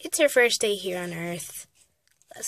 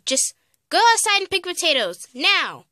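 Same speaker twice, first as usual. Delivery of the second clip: raised, impatient command; stern order; 5 semitones higher than usual. Acted. Mother sends daughter to pick potatoes, stern order. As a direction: order her out at once.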